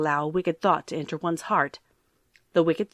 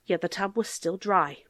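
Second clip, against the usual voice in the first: ordinary delivery, nothing marked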